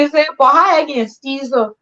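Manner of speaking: ordinary delivery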